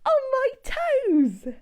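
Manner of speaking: high loud squeal at cold water; chilly squeal. Acted. Telling children about chilly toes, high squeal. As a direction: squeal it like icy water touched you.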